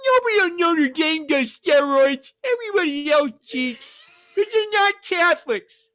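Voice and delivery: put-on high, loud old football coach pep talk; rally the team like a folksy old college coach; Lou Holtz impression. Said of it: "You love my Lou Holtz".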